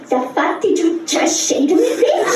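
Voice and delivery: indignant slushy lisp, "say" as "shay"; outraged lisping kid; childhood lisp. Acted. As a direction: challenge them with "just say" slurred into sh sounds. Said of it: "'cause I had a lisp".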